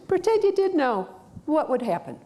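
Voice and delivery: high, bright coaxing question, Pretend lifted way up; provocative question. Shown as a demonstration. Coach provokes the client, pitched high and coaxing. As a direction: tease the answer out with a lifted voice. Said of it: "you answer with".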